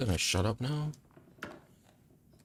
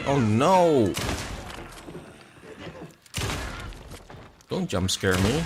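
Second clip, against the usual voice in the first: scared horror game streamer; 7 dB louder than usual. Real, not acted. Startled streamer during horror game.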